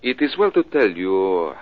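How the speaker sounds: hammed-up French accent, posing as a Frenchman. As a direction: play a smooth Frenchman with a stage French accent. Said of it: "Gonna try out your French accent?".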